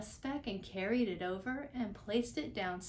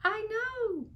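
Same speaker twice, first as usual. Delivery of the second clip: very high, louder call; tiny Who calling; 12 semitones higher, 6 dB louder than usual. A narrator's reading. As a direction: call up from far below. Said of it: "called the voice".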